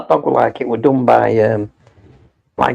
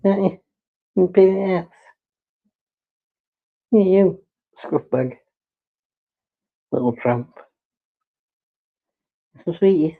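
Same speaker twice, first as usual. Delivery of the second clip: pet talk; 7 semitones higher than usual. Real, not acted. Owner cooing fond insults at her dog in high baby talk. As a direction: fondly tease the dog in a high voice.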